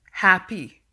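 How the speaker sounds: "Happy" with a loud first syllable, HAP-py; stress on HAP. Shown as a demonstration. Teacher repeating the correct word for learners, HAP stressed in "Happy". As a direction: punch HAP and let the end of "Happy" fall. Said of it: "we say hap with stress".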